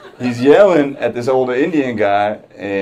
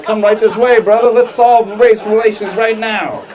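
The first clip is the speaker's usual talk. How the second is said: a hint of an overly friendly salesclerk, higher and louder, slowed; mock helpful salesman; 12 semitones higher, 7 dB louder than usual. Comic imagining helping the rich guy as a clerk, hammed-up friendly voice. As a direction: play the eager clerk leading him over. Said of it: "I could be like".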